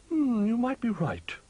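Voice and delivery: owl character voice. A narrator's reading. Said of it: "said Hoot".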